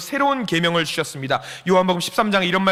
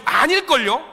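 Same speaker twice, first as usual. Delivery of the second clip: normal talking voice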